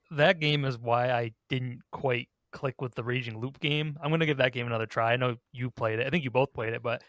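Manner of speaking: ordinary talk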